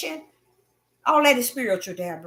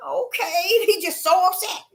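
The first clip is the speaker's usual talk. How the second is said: usual speaking voice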